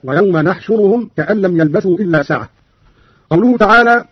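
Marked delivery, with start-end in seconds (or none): 0.0-2.5 نحشرهم opening with an "n"; nun of majesty. Shown as a demonstration. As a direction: recite نحشرهم as "nahshuruhum". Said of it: "قرأ قالون بنون العظمة هكذا".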